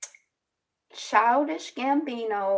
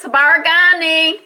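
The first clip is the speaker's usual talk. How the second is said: loud greeting; 8 semitones higher, 9 dB louder than usual. Real, not acted. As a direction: call out the greeting to the viewers. Greeting viewers in Swahili, loud.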